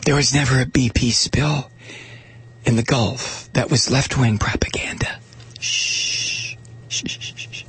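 whispering over a crib. Retold or imitated. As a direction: lull them back to sleep. Continuing the hushing ad voice.

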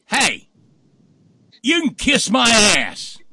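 loud, high holler with the last words dragged out; hammed-up indignant comeback; defiant shout back. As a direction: yell a retort across the field. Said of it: "blonde in the canoe in the middle of the field says".

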